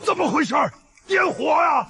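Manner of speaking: frantic yelling. Acted. Villain whose explosives fail to ignite, frantic yelling. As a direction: yell in baffled desperation. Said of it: "别喊了，费嗓子".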